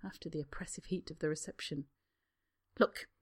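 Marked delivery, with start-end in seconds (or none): 2.8-3.1 louder, irritated snap; Harry snapping back at Draco in irritation. Acted. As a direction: bite the words off. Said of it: "Harry snaps".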